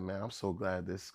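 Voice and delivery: low, soft admission; hushed setup to a punchline; confiding mother. Retold or imitated. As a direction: imitate a mother's private relief. Mother's private confession.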